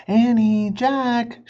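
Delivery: put-on loud, high call to the kids. A narrator's reading. Mom calling Annie and Jack from far away, loud and high. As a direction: sing out their names from the house. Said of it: "she called".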